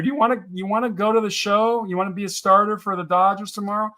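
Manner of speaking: mock recruiting question; enticing offer. Voicing an exciting offer to player.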